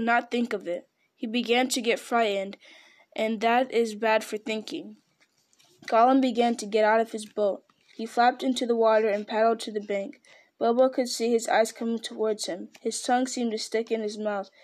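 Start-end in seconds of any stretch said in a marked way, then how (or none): none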